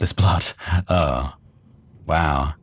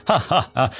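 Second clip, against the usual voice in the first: laughing; 6 semitones higher than usual. Acted. Chuckle to yourself narrated.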